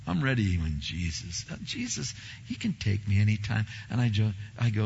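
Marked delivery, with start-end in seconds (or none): 0.0-3.7 calm acceptance. Retold or imitated. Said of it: "You're not, like, freaked out?".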